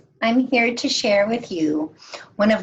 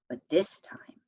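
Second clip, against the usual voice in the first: hushed whisper; 4 semitones lower, 9 dB quieter than usual. Acted. Narrator hushing listeners at the twist, whispered. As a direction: whisper it, a storyteller's hush before the twist. Turning point of the story.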